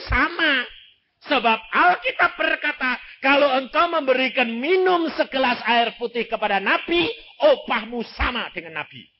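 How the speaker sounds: rising pulpit fervour; fiery preaching. Acted. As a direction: lift the church with it. Preacher builds to full force.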